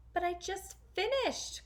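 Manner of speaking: put-on high, pleading girl's voice; crying out. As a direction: protest in a high young voice. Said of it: "cried Cinderella".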